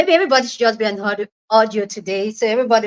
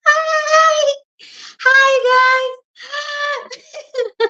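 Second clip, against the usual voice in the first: excited squeal; much slower than usual. Real, not acted. Excited greeting of stream joiners.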